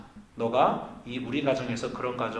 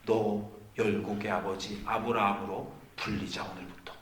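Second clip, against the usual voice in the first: softer, lower, quietly bestowing a name; gentle blessing; 5 semitones lower, 7 dB quieter than usual. Acted. Pastor voicing God naming Abraham, soft and tender. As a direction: hush down and bless him tenderly. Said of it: "이렇게 하시는 거예요".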